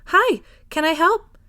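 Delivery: high, slow sing-song greeting; high feminine voice. A narrator's reading. Bookshop owner calling to customers, high and friendly. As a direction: call out brightly from behind a shelf. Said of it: "a high, feminine voice called out".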